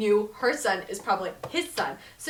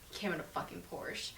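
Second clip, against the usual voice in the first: whispered aside; 3 semitones lower, 12 dB quieter than usual. Real, not acted.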